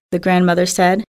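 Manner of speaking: ordinary delivery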